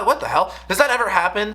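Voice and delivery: ordinary talk, nothing marked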